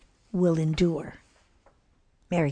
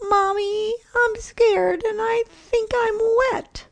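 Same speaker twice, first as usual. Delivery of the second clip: put-on much higher small child voice, scared; fretful little child; 3 dB louder, slower than usual. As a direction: whimper it like a tiny kid. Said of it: "the youngest began to fret".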